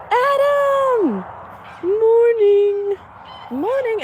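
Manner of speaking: high sing-song calling out; high pet call. Real, not acted. Calling out to the animal.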